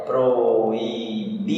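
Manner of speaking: "Proibido" slowly in syllables, pro-i-BI-do, the bi strongest; syllable-by-syllable "Proibido" with a long, stressed BI. Shown as a demonstration. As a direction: draw out "Proibido" and lean on bi. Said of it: "O bi foi mais forte".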